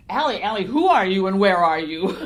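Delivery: loud calling that builds, breaking into laughter; exasperated mock yell. Author acting out her reply to the voices, loud comic exasperation. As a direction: re-enact your own baffled shout, amused. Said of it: "And I'm going".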